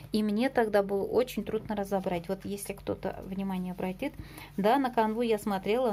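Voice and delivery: usual speaking voice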